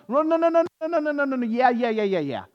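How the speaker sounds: rapid-fire high, loud brush-off; pitched high, sliding down at the end; mock dismissive insistence. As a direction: imitate a know-it-all talking over everyone. Mimicking people who insist otherwise.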